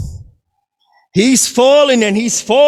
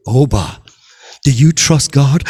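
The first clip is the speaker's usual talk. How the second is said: voice of God; 11 semitones lower than usual. Acted. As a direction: speak calm and low as God. God questions the climber.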